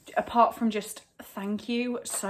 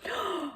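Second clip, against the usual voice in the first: sharp high intake of breath in surprise; a quick high startled gasp; surprised gasp; 10 semitones higher, 4 dB quieter than usual. Real, not acted. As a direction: gasp at what's inside. Opening surprise parcel.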